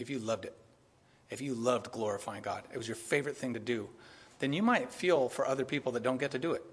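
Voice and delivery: ordinary talk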